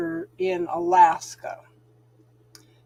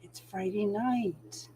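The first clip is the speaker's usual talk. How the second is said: quiet; 9 dB quieter, slower than usual. Real, not acted. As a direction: settle into the evening, hushed and slow. Relaxed Friday night livestream.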